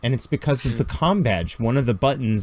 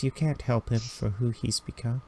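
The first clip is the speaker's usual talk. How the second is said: Sasha, quietly; 9 dB quieter than usual. Acted. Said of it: "and Sasha goes".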